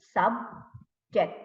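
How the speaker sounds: "Subject" split as sub, ject with a long gap; syllable by syllable. Shown as a demonstration. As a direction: say "Subject" in two separated beats. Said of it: "how many syllables".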